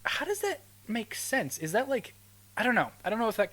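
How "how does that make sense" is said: incredulous. Real, not acted. Incredulous high question.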